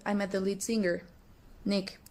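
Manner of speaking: ordinary talk, nothing marked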